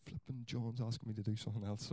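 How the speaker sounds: a churchgoer grumbling about the pastor, muttered; muttered grumble. Retold or imitated. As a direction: grumble it to yourself so nobody hears. Grumbler muttering under breath.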